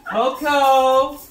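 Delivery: high, loud call across the house. Real, not acted. Owner calling Coco to come meet the new dog, loud high call. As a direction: call the dog from another room.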